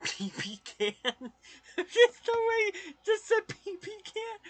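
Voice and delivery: high-pitched, cracking up; real laughter breaking into the words; laughing. Real, not acted. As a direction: squeal it through helpless laughter. Laughing at a joke pronunciation.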